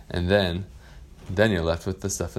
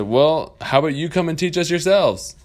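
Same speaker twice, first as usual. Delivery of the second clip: higher, louder daring challenge; cheeky kids; 9 semitones higher, 6 dB louder than usual. Acted. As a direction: dare the pirate to teach them. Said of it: "they said".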